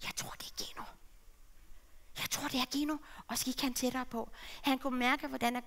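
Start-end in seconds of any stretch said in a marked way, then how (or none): 0.0-0.8 hushed unvoiced aside; breathless whisper. Shown as a demonstration. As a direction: whisper it to yourself. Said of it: "demo before quote".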